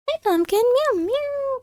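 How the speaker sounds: high, cooing pet voice with meows. Real, not acted. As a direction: coo at the cat in a high squeaky voice. Talking to her cat.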